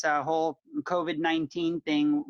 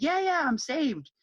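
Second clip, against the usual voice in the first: mock eager believer; 10 semitones higher, slower than usual. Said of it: "And they're like".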